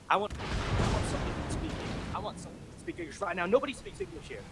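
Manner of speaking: very high, squawking demand; mock a caller having a fit; irate customer. Customer demanding somebody who speaks English, high mock outrage.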